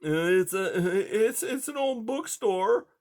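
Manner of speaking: high, hesitant scared mouse; timid high little voice; frightened mouse. A narrator's reading. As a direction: stammer it out in fear. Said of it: "the thoroughly frightened mouse said".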